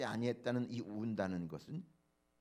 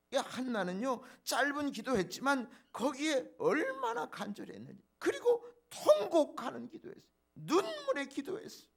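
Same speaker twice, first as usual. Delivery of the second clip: rising, louder and higher, building to a cry; preaching at full force; 12 semitones higher, 5 dB louder than usual. Acted. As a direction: drive the point home with fervour. (열정적으로 외치는 설교)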